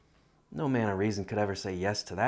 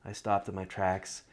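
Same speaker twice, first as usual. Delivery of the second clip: plain reading voice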